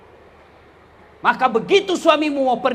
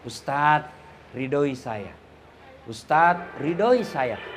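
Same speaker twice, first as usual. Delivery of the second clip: lower, softer pleading; lightly voiced tender wife; 9 semitones lower, 5 dB quieter, slower than usual. A mild imitation of the wife pleading tenderly to the preacher. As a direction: coax him softly and sweetly. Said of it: "Lalu dia usap dadaku".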